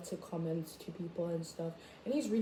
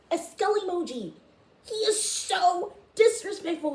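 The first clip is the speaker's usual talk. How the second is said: outraged mom; 9 semitones higher, 4 dB louder than usual. Retold or imitated. Voicing her outraged mom.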